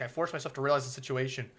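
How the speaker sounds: ordinary talk, nothing marked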